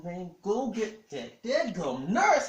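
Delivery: high, loud, slow mock order; exasperated demand. Wife in labor cutting off husband's breathing advice, exasperated. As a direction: imitate a wife demanding, each word drawn out.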